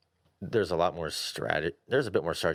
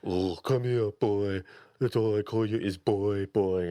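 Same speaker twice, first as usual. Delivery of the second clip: higher, mocking sing-song boy; teasing son parroting father; mocking kid; 4 semitones higher than usual. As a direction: mimic the stern dad with a sneer. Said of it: "the kid will mock you".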